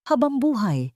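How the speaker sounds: normal talking voice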